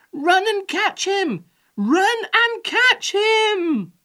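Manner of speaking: high, loud urgent command; shouting. A narrator's reading. Old woman urges the old man to give chase, shouting. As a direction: shout it, stretching out the repeat slowly. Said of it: "shouted the old woman to the old man".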